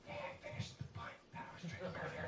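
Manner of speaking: barely audible, voiceless whisper; hushed discreet whisper. Acted. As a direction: whisper it so nobody notices you. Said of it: "try not to make a scene".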